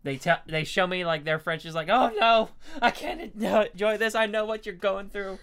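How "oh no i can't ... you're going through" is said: high, loud pitying voice; hammed-up sympathy, pitched way up; mock dismay. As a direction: act out an exaggerated, high-pitched lament. Acting out reaction to Frenchie owners.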